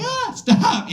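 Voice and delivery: squeaky startled "stop"; high squeak at a mean-mugging cat; high mock yelp. Hissed at by cats.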